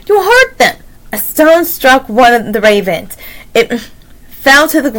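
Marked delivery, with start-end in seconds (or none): 0.1-0.8 high-pitched plea; crying out. A narrator's reading. Annie pleads for the stoned ravens, crying out. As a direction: protest in a raised, urgent storybook voice. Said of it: "cried Annie".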